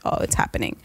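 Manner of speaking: hushed under-the-breath realization; dad realizing quietly; whispered realization. Retold or imitated. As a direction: whisper it to yourself. Dad's quiet alarm.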